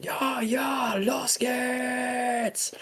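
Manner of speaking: very high mock battle yell, held back in volume; imitated German yelling. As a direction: mimic German troops yelling, very high and drawn out. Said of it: "a bunch of Germans just yelling".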